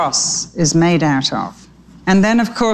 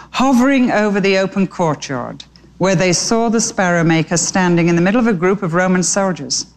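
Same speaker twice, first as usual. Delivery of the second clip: ordinary delivery, nothing marked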